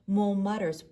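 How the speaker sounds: plain reading voice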